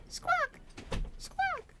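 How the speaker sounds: high squawking bird cry. Pretend. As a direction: screech like a bird. Bird screech imitation.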